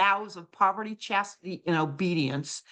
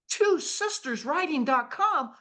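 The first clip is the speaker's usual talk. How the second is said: higher, puzzled repeat of the name; surprised echo; 7 semitones higher than usual. Retold or imitated. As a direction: echo it back, baffled by the coincidence. Echoing the name in disbelief.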